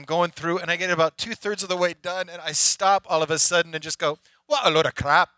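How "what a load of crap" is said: loud Scottish outburst; Scottish accent. Retold or imitated. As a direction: blurt it out in a thick Scottish accent. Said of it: "I don't know why I got Scottish".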